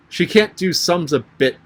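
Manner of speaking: "She can't do sums a bit" pitched high with firm stresses; great emphasis. A narrator's reading. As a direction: press hard on "can't do sums". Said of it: "said together with great emphasis".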